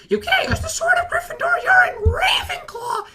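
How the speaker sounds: outraged falsetto mock shriek; Harry Potter. Boy objecting to a Ravenclaw using the sword, shrill mock outrage. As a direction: protest in a very high, squealing yell. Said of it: "he just goes".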